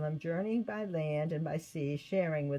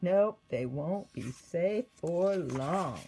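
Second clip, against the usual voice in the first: slow, grim and resolute; slow menacing Saul; 4 dB louder, slower than usual. Acted. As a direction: let the threat sink in slowly. Saul's thought, slow repeat.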